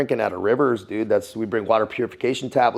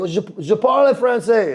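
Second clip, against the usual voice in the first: bad French accent; 10 semitones higher than usual. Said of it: "my accent's so fucking terrible".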